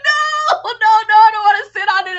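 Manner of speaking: high, loud, fast panicked no; mock panicked refusal. Refusing the guide, loud, high and fast. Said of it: "I was like".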